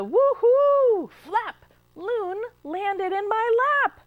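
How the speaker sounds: high and loud, bursting with glee; high, loud excited narration; excited woo-hoo. A narrator's reading. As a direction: shout the woo-hoo like a wild bird landing. Loon flaps in loudly.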